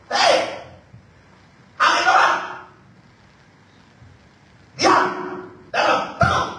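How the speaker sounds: loud, high command to the wind; Jesus shouting down the storm, loud and commanding. Acted. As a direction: order the wind to be still.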